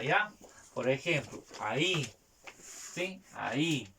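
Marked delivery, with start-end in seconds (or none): 1.6-2.1 "ahí" stressed on the final í; stress on last syllable. Shown as a demonstration. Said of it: "en la última sílaba". 3.3-3.9 "Ahí" with the weight on the final í; stress on last syllable. Shown as a demonstration. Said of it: "en la I".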